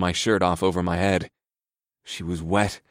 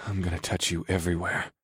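soft promise; 6 dB quieter than usual. A narrator's reading. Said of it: "I promised her".

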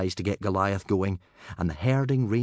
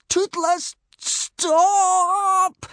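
high, loud, stretched-out cry; strangled shout; 10 dB louder, much slower than usual. A narrator's reading. Hiccup calling back his charging dragon, strangled shout. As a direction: shout while straining to stay hushed. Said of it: "trying to shout and be quiet at the same time".

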